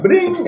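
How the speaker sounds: high-pitched "bling" like a phone ping; imitated text alert. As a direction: mimic a phone notification going off.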